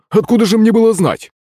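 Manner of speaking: high, indignant excuse; protesting cry. A narrator's reading. Frog excusing himself to Серёга, indignant cry. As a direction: plead ignorance at a high pitch. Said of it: "вскрикнула лягушка".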